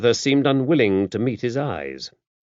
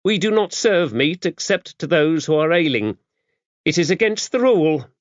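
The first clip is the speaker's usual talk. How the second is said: high-voiced, brisk, no-nonsense abbess; a worldly, gruff nun laying down the rules; gruff old abbess; 9 semitones higher, 4 dB louder than usual. A narrator's reading. Dame Tabitha briefing the visiting priest, gruff and brisk.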